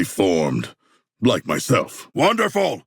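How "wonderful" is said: delighted. Acted. Delighted after getting clean.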